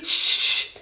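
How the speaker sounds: breathy whispered shh, imitating her; mock shushing whisper; imitated shushing. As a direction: hush someone the way she did. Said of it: "she's trying to calm me down".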